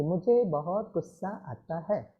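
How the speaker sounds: calm, affectionate admission; said lovingly. Shown as a demonstration. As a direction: soothe yourself, "मुझे" said softly and tenderly. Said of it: "उसको प्यार से बोलो".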